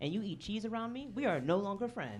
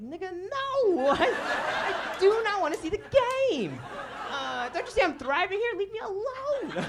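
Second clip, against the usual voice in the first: exasperated yell; 14 semitones higher, 10 dB louder than usual. Pretend. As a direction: blow up at him, laughing through it. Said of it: "but I don't say that".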